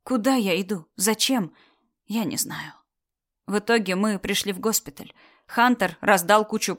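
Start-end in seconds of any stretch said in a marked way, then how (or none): none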